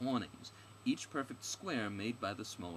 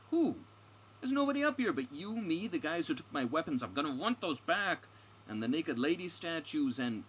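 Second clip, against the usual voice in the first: high, loud, indignant outburst; ranting heroine; 8 semitones higher, 7 dB louder than usual. Acted. Stranger demanding who hired the guide, indignant rant. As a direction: rant faster and higher, outraged.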